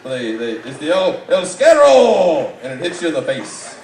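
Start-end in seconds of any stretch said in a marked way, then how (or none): 0.9-2.5 loud, slow, drawn-out announcement of El Scarro; mock grand announcement. Roasting the guest, booming the burrito's name. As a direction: boom it out like a wrestling announcer.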